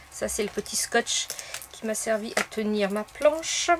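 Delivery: normal talking voice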